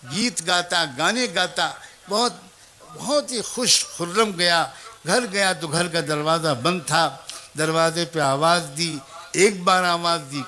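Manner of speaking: normal talking voice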